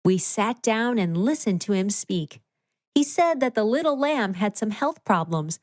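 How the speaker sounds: plain reading voice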